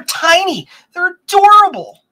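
high, loud squeal of delight; gushing. Real, not acted. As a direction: squeal over something cute. Gushing over tiny computers.

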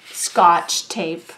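ordinary delivery, nothing marked

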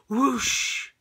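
loud whooshing effect word; storybook whoosh; whoosh sound effect. Acted. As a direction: make the sound of it flying off. Couch falls off car.

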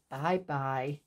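normal talking voice

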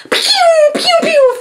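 high-pitched "pew" firework noises; imitated fireworks. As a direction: make rockets shooting off with your voice.

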